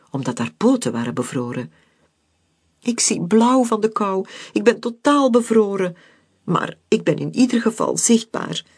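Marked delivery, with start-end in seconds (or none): 2.8-8.6 high, squeaky and relieved; lightly voiced little piping voice; relieved squeak. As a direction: pipe it out, smiling with relief. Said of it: "pieperde ze met een opgelucht glimlachje".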